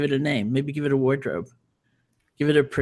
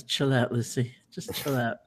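soothing; 5 dB quieter than usual. Retold or imitated. Calming her inner critic.

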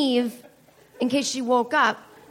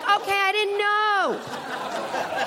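high, drawn-out mock whine; hammed-up kid on the defensive; defensive protest; 8 semitones higher, much slower than usual. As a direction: protest innocence in a high, stretched voice. Defends herself to shocked classmates.

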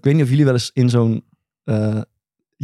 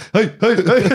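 higher mock huddle chant; raised, mocking "hey" chant; cheering trainers' huddle; 5 semitones higher, much slower than usual. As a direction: imitate coaches cheering in a huddle. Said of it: "één manier van juichen".

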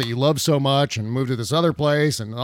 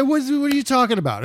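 incredulous; 10 semitones higher, faster than usual. Retold or imitated. Mocking their own baffled reply.

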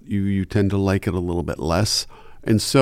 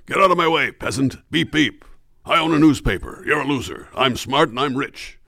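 haughty voice, pitched higher, looking down on everyone; snobbish rich man; 5 semitones higher than usual. Acted. Voice actor demoing a rich snob character, haughty and high. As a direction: sneer at the peasants from above. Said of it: "like everybody's beneath him".

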